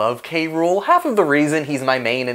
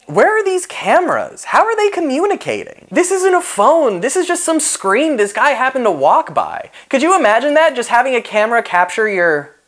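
incredulous; 7 semitones higher than usual. Real, not acted. Ranting at the show's logic.

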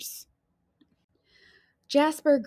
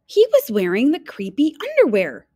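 dramatic reveal; 6 semitones higher, 7 dB louder than usual. A narrator's reading. Shock reveal in story.

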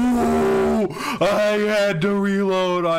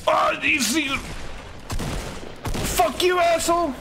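high, furious outburst at the enemy; real gamer rage shout; angry yell; 9 semitones higher than usual. Real, not acted. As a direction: snap and yell it. Gets spotted, yells at enemy.

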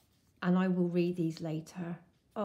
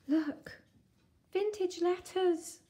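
much higher, drawn-out cry of delight; thrilled; 10 semitones higher, slower than usual. Real, not acted. Thrilled at vintage letters.

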